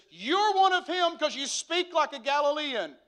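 high, loud mock accusation; lady's loud accusation. Lady accusing Peter over his Galilean speech, loud mock accusation. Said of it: "and the lady says".

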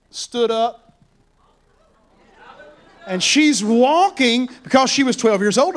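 ordinary talk

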